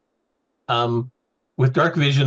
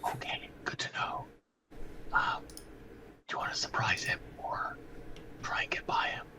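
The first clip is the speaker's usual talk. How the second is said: stage whisper; 13 dB quieter than usual. Real, not acted. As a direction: hush it to the party. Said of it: "We'll say this is a stage whisper".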